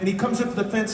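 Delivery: ordinary delivery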